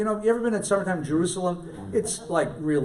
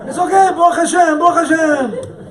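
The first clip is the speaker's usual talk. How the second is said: higher and louder Yiddish-Brooklyn caricature; hammed-up Yiddish accent of a Brooklyn Chabadnik; a friend laughingly imitated in a Yiddish Brooklyn accent; mock Yiddish Brooklyn accent; 11 semitones higher, 11 dB louder than usual. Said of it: "as if you're born and bred in Yiddish-speaking Brooklyn".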